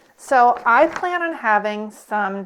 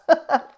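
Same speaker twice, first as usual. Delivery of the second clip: giggle; 7 semitones higher, 3 dB louder than usual. Real, not acted. Keeps laughing at herself.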